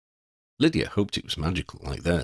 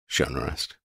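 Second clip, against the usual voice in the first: plain reading voice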